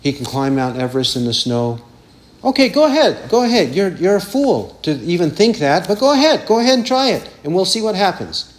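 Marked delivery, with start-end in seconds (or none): 2.4-8.4 mocking challenge, pitch up; taunting. Shown as a demonstration. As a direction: dare the fool to try it. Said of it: "That's taunting".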